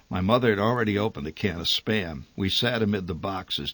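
ordinary delivery

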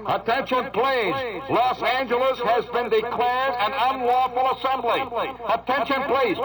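hammed-up official loudspeaker announcement, far off; police megaphone warning to the party crowd; police helicopter loudspeaker. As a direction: broadcast it from a helicopter overhead. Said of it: "twenty-eight police helicopters saying".

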